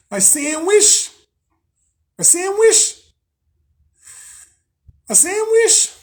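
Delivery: high, slow, loud singsong on sandwich; silly singsong sandwich. Acted. Eager vlogger crooning about lunch, high singsong. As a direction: stretch each sandwich up high and playful like a hungry kid. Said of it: "I have to go eat my sandwich".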